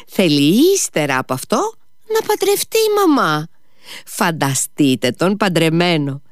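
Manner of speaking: high-pitched, giggly mockery without real laughter; excited teasing that rises in bursts; nervously amused teasing. A narrator's reading. Lise ridicules Alyosha marrying to mama, nervously amused. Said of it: "όλο και γελούσε με το νευρικό μικρό γελάκι της".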